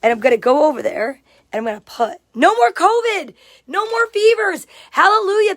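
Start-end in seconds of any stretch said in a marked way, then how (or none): 2.3-5.5 high, loud happy cheer; joyful cheer. Real, not acted. Cheering a friend's good news read from the chat.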